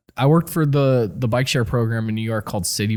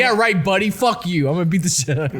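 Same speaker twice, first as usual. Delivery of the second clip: higher, louder mock threat, hammed-up; mock tough-guy threat; 7 semitones higher, 3 dB louder, faster than usual. Friend hams up a guy threatening a fake accent, mock angry. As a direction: play a bristling tough guy calling out a faker.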